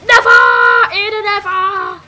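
high, very loud battle cry; rally the team in alarm; desperate loud shout; panicked shout. Real, not acted. Defending objective in panic.

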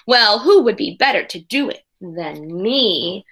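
ordinary delivery

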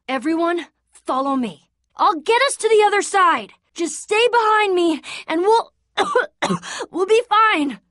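rallying shout with a cough in the middle; forced brave call. Acted. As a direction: call out to the group like you're in charge, covering weakness. Calling group to follow him.

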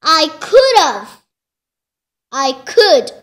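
loud frustrated complaint. Real, not acted. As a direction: vent the annoyance.

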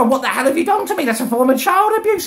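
fast, high-pitched accusation; outraged. Retold or imitated. Trying to get through to mum, outraged.

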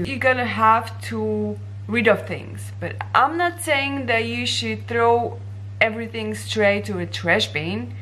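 ordinary delivery

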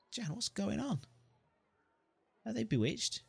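high, worried questions; puzzled hero. Acted. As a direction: ask Jan what strange thing is happening. Hero alarmed at village.

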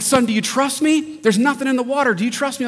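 high-pitched mock dad voice; coaxing dad. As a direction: playfully act out a dad urging his kid to trust him. Acts dad coaxing frightened son.

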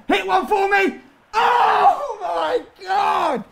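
high, drawn-out astonishment; amazed goal shout. Real, not acted. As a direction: cry out as the screamer goes in.

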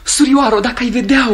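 "surioară" high and loud, bursting out; rapturous exclaim. Acted. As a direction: cry out in love-struck delight. Lover's rapture.

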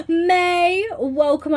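high, drawn-out delighted call; high-pitched happy hail; excited greeting. Real, not acted. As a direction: greet a dear friend arriving, pitched right up. Streamer greets arriving friend.

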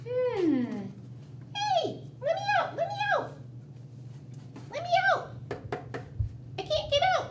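very high squeaky mouse calling out; trapped little mouse begging. Acted. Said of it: "I'm Miss Mousy".

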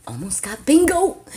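nearly there, bursting with excitement; 1 excited. Real, not acted. Streamer to viewers near a bingo, thrilled loud cry.

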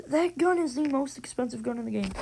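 usual speaking voice

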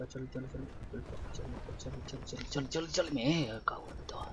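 soft repeated murmur, trailing to whisper; muttering under breath. Real, not acted. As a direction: mutter it over and over to yourself. Repeating point name under breath.

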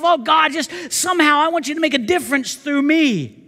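high, earnest mock prayer; a touch of pleading prayer; voicing a prayer. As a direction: plead to God as the listener would. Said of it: "You ever think to yourself".

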